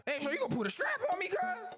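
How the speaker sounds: high fast taunt. Pretend. Slaver taunts Schultz.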